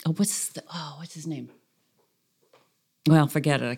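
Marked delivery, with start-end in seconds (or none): 0.2-1.5 whispering. Real, not acted. Searching for a name.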